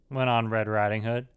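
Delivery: plain reading voice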